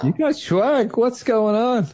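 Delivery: squeaky high "You got swag?" in fake shock; high, teasing disbelief at his friend's boast; mock amazement. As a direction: act gobsmacked, way up high.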